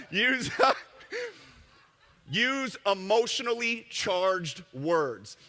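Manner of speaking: slow, words spilling through laughter; laughing through it. Real, not acted. A presenter cracking up at his own slide, reading through laughter. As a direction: read the slide while still laughing.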